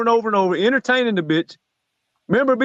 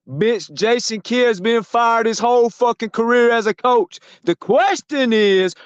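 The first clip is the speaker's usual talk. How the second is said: slow, deliberate jab; slow emphatic; slower than usual. Real, not acted. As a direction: spell it out, slowly. Slow emphatic rant point.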